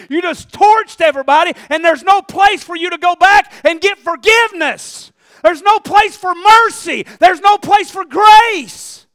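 high, shouted preaching with stretched key words; full-force preaching. Real, not acted. Preacher warning congregation about words said in anger, full-force preaching. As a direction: cry out each line to the whole room.

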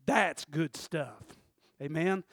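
usual speaking voice

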